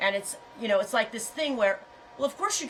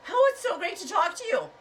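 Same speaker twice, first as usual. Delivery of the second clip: mock gushing; 11 semitones higher, 3 dB louder than usual. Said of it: "like, say".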